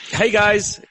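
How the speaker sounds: high-pitched fake Canadian greeting; bad Canadian accent. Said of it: "Your, your Canadian accent is almost as bad".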